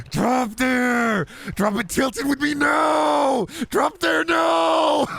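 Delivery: high, slow, yelling gamer voice; a frantic streamer yelling high-pitched callouts; Connor O'Malley impression. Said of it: "Connor O'Malley doing a Fortnite Let's Play".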